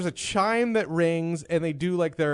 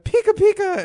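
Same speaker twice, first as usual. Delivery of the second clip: very high, louder cutesy squeal; teasing waitress impression; 6 dB louder than usual. Waitress teasing with bunny ears, high cutesy imitation. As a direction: squeak it out like a cartoon critter. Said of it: "put up, like, like, little bunny ears and went".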